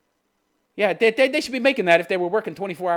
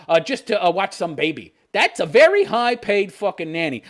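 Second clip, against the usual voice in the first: usual speaking voice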